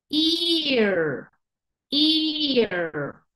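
"Ear" with a drawn-out eee before the r; long E in ear. Shown as a demonstration. Teacher models the word, very long vowel in "Ear". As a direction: stretch "Ear" slowly, eee-r.

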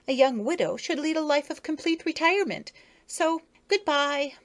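put-on a coy young lady beetle; Effie, high voice. A narrator's reading.

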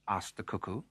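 ordinary delivery

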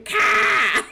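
Boston car shouted high and loud as cah; mimicking her son's Boston-sounding car; Boston accent. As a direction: belt a Boston cah like a toddler. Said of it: "apparently he's from Boston".

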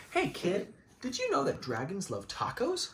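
animated read-aloud for little ones; reading to children. A narrator's reading. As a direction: tell it like a bedtime story for kids. Reading the picture book aloud.

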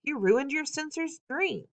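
high-pitched, mild telling-off; scolding. A narrator's reading. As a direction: scold a little child, voice lifted. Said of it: "she scolds".

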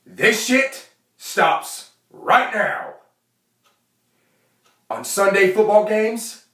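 hammed-up stern big brother; big brother laying down the law. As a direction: lay down the law like the captain of the house. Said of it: "exactly how I'm fixing to act it".

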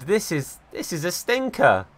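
ordinary talk

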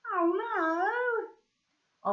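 high, startled voice of a different character; dismayed cry. Acted. Mum spotting the burned tablecloth, a high dismayed cry. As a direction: gasp it out in alarm.

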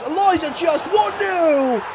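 all-out goal celebration; ecstatic goal call. Real, not acted. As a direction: scream the scorer like a delirious fan. Opening goal in the final.

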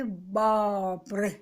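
long drawn-out exclamation; slow "oh my goodness"; awed dismay. A narrator's reading. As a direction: stretch it out in amazement. Awed at the official's anger.

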